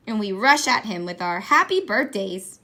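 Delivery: plain reading voice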